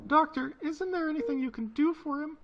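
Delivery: high, urgent plea to a doctor; pleading. Acted. Wife begging doctor to save her husband, pleading.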